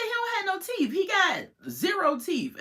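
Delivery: high, insistent correction; high-pitched mock argument with Melissa; exasperated retort. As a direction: set her straight, way up high. Arguing with Melissa in her head.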